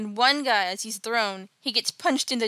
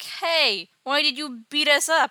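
higher, louder mock outrage; indignant protest; 5 semitones higher, 5 dB louder than usual. Beaten men confront Dick, indignant protest. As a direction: complain like wronged men, pitched up.

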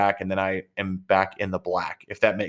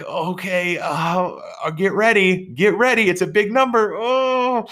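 nervous clerk; 10 semitones higher, slower than usual. Retold or imitated. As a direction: play the clerk working herself up over the number. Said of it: "she was so nervous".

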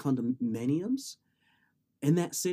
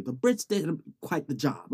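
ordinary talk

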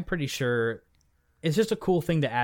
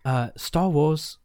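amused imitation of a British accent; attempted British accent; 4 dB louder than usual. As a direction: try on a British accent, half-hearted. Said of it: "Do your best".

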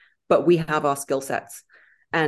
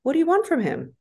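exasperated; 11 semitones higher than usual. Real, not acted. Exasperated rhetorical question.

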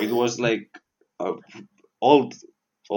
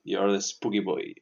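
ordinary delivery

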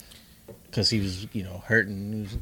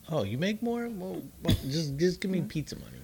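higher, softer, playing the ex-wife asking for money; mock ex-wife voice; 8 semitones higher, 4 dB quieter than usual. Teasing the co-host about divorce, playing the ex-wife higher. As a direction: pitch it up into a mock ex-wife, half-joking. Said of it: "Like, just, just".

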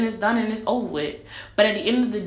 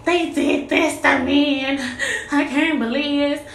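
high, whiny self-pitying wail, hammed-up; mock tearful complaint; 6 semitones higher than usual. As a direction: play the wronged victim, mock crying. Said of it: "I'm finna be like".

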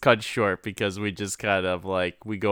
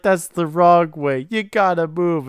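mock panicked yell; 7 semitones higher, 6 dB louder than usual. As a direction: yell it frantic and high. Woman screaming warning retold.

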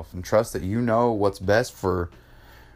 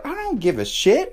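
high, emphatic dismissal; insistent, raised-pitch reassurance; retold pep talk. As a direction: act out a dad brushing off the worry. Retold firm pep talk to daughter.

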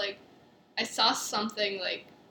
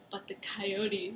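slow, soft, fearful murmur; quietly hammed-up fear; mock timid worry; 2 semitones lower, 8 dB quieter, much slower than usual. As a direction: drag it out like a scared kid. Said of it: "she's like".

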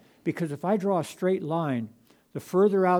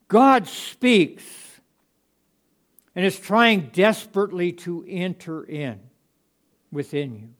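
loud, raised preacher insistence; preaching at full force; 3 semitones higher, 8 dB louder, slower than usual. Acted. Preacher insisting to the congregation that God speaks, full force. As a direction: hammer home that God speaks.

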